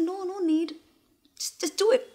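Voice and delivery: panicked plea. Acted. Woman begging Ajay to skip looking at her, high panicked plea. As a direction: squeak it out, desperate to avoid being seen. Embarrassed woman fends off lover.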